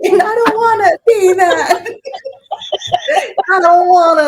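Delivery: high, tearful-laughing mock whine. Client dreading turning out like untreated family, laughing mock whine. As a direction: protest in a hammed-up high wail, breaking into laughter. Comic protest at ending up untreated.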